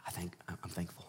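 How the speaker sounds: soft unvoiced whisper; whispered thanks.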